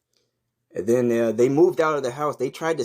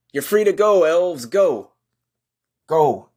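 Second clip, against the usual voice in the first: loud, high shout to the elves; 5 semitones higher, 6 dB louder than usual. Acted. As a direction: call out to free them.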